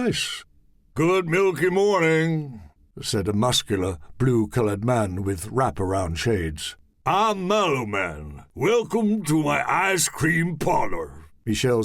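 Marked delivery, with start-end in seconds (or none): 0.9-2.6 slow, higher showman greeting; cheerful host welcoming guests, slowly; Mallow Man voice. Acted. 7.1-11.3 slow, higher showman greeting; cheerful host welcoming guests, slowly; Mallow Man voice. Acted. Mallow Man introduces himself.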